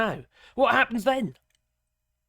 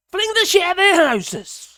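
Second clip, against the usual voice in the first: loud, high yell of outrage; angry shout; 9 semitones higher, 8 dB louder than usual. Real, not acted. Vlogger urging protest against politicians, loud angry shout. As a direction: hurl the line out furiously.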